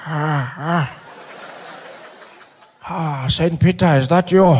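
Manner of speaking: put-on slow, groaning old man's voice; Nelson Mandela. Ailing leader thinking he reached heaven, dazed slow voice. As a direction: drag it out as a dazed elder.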